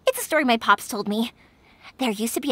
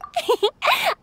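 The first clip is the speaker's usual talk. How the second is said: light giggle; 13 semitones higher than usual. Acted. Yoimiya laughs at her own idea.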